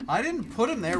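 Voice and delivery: high, loud indignant denial; loud protest. Real, not acted. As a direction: insist loudly it wasn't you. Protesting a crash in race.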